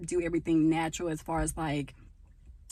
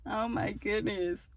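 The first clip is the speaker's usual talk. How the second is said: sighing laugh; 4 semitones higher, slower than usual. Real, not acted. Calming after laughing fit.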